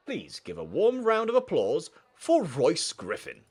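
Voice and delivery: stately school-speech voice, building to the introduction; principal addressing a rally. Acted. Voices Mister Lance's speech to students.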